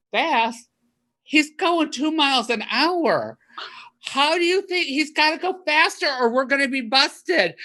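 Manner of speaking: high, incredulous mock yell; retold yelling. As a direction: yell back in disbelief. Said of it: "kept yelling back and forth".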